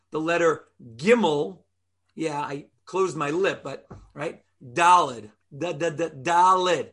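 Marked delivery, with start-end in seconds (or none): 5.6-6.1 "da, da, da" tapped with the tongue, lips apart; crisp tongue da demo; da sound demo. Shown as a demonstration. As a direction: show the tongue letter da. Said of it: "I don't touch my lips".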